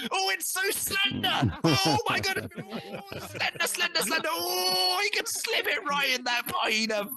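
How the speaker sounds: high, slow, breathy falsetto swooning; swooning admirer. Pretend. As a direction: gush over him in a shrill, drawn-out falsetto. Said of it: "they'd be saying".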